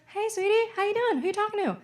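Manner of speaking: high, sing-out motherly greeting; acted mom. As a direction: imitate a mom sweetly checking on her kid. Mom voiced greeting her child.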